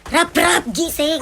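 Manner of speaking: loud mother rousing a sleeper; shouted, impatient wake-up call; angry wake-up yell. Acted. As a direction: yell to get them out of bed. Mother yelling to wake son.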